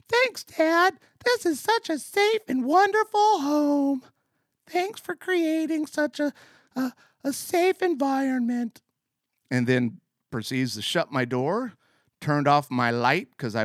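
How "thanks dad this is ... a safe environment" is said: put-on very high, small kid voice; prepubescent boy voice. Boy thanking his dad at bedtime, very high kid voice. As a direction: squeak it out like a boy before his voice broke. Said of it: "'cause I still hadn't gone through puberty yet".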